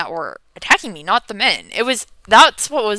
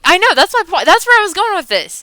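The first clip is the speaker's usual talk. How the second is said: excited; 12 semitones higher, 8 dB louder, faster than usual. Real, not acted. Reacting to chat comment.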